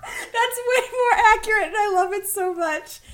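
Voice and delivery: words squeezed out through laughter; laughing. Real, not acted. Laughing at cohost's synopsis.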